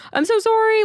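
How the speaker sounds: high, loud panicked apology; frantic apology. Retold or imitated. Apologizing to the woman on the escalator, high and frantic. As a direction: blurt it out, mortified.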